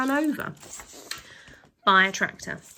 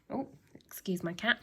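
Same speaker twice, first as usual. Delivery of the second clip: plain reading voice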